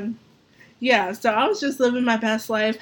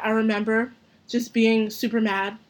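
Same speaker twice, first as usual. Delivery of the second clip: ordinary talk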